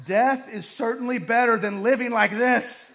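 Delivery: loud, high dramatic outburst; Jonah's exasperated exclamation, read at full volume; exclaimed. A narrator's reading. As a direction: cry it out in full meltdown. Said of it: "he exclaimed".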